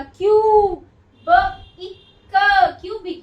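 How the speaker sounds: क्यू loud and separate, then ब इ क, then क्यूबिक; sounding out syllables. Shown as a demonstration. Teacher to class, sounding out क्यूबिक. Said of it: "long sound देगा".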